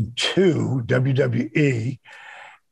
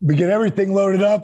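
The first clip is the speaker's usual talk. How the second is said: ordinary delivery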